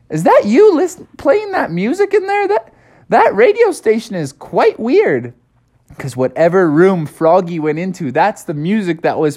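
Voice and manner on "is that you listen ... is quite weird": high, swooping motherly call; high-voiced, querying mother frog; Froggy's mom. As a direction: call puzzled into the next room. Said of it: "Froggy's mom was listening".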